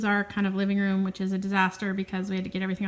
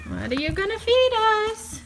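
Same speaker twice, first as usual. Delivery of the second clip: pet voice; 13 semitones higher than usual. Pretend. Voicing the cats.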